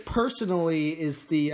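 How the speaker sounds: usual speaking voice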